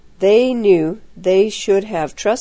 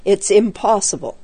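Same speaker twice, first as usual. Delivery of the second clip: usual speaking voice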